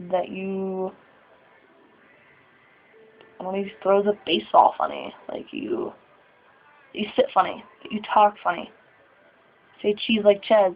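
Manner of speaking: normal talking voice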